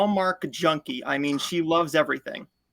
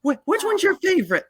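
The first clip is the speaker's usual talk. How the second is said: put-on high trembling old lady voice, much higher; shaky excited old lady; 13 semitones higher than usual. Hands-shaking fan asks about favorite movies, high and trembling. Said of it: "she's shaking".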